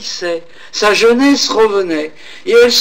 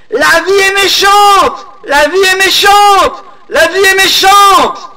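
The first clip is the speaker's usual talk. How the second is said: very high, loud, slow wailing shout; crying out; 8 dB louder than usual. Acted. Old woman at the cliff edge, crying her despair, in a high slow shout. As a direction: hurl it at the valley from the cliff. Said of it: "et elle cria".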